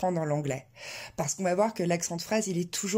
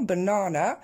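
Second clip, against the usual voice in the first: BANANA with long middle NAA, rising at the end; second-syllable stress; 3 dB louder, slower than usual. Shown as a demonstration. Teacher pointing at the underlined word, English-stressed banana. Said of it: "l'accent tonique qui tombe sur du coup la deuxième syllabe".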